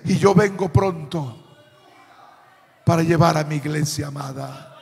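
hushed, low voice of promise; tender promise. Retold or imitated. Cita tierna de la promesa. (promesa tierna en voz baja)

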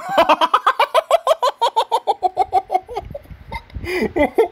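high, squealing burst of laughter; can't stop giggling; giggle fit. Real, not acted. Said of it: "I'm sorry for laughing".

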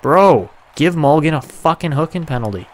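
high, loud demand at the ref; angry shout. Real, not acted. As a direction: bark it at the referee. Demanding a penalty call.

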